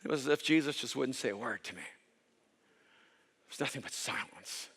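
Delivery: low, hushed, dropping to a breathy whisper; dramatic near-whisper. Acted. Preacher describing God's silence, fading to a whisper. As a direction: let the voice fall away into silence.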